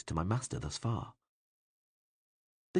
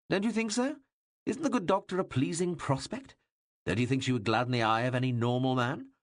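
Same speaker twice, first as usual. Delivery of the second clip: higher, louder mocking royal voice; teasing king; 5 semitones higher, 4 dB louder than usual. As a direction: needle the shy assistant, grinning. King presses Oelf.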